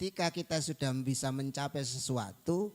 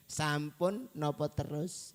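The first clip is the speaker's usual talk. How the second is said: slow teasing question; slower than usual. Shown as a demonstration. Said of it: "ngertes maksudnya sampun itu apa?".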